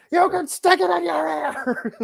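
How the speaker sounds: high, slow, laughing wrestler taunt. Retold or imitated. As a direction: ham it up through a laugh. Imitating Piper again.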